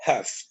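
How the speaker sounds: "half" with a short open vowel, "haf"; correct "half". Shown as a demonstration. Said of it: "Então, você vai pronunciar".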